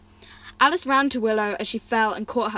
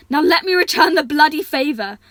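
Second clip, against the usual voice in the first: higher, louder with resolve; determined; 6 semitones higher, 9 dB louder than usual. Acted. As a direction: spit it out with grit. Luca determined rescue line.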